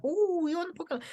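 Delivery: high-pitched delighted squeal, imitating mum; mock-excited, very high voice. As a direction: coo it like a thrilled, proud mum. Mum's delight retold.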